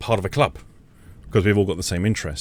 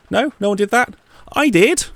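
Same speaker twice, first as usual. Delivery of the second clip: much higher, louder indignant; rising indignation, pitched up; 11 semitones higher, 4 dB louder than usual. Real, not acted. Indignant rant.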